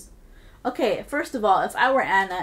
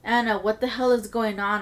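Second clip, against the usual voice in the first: plain reading voice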